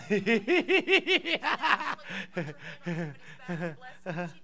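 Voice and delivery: laughing fit that slowly winds down; long laugh. Real, not acted. Preacher after sowing into her family, long rippling laugh.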